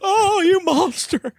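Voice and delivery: laughing. Acted. Laughing through horrified repeat.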